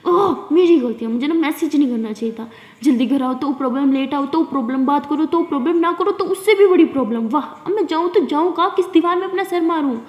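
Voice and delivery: put-on sulking girl ranting dramatically at her friend; dramatic sarcasm. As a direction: ham up the martyr complaint. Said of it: "इतना over acting करने की ज़रूरत नहीं है".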